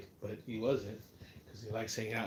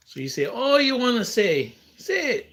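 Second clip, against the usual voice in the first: much higher and louder challenge; loud taunt; 12 semitones higher, 14 dB louder than usual. Real, not acted. Daring the viewer to say it, loud and taunting. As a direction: goad them, rising each time.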